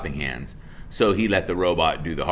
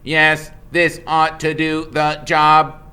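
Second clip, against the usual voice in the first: slow, level robot voice trying to be friendly; a machine doing its kindest tone; 8 semitones higher, 10 dB louder, slower than usual. Acted. Roz reassures Mister Beaver in a slow, friendly robot voice. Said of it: "in her friendliest voice".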